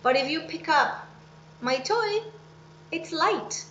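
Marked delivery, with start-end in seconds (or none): none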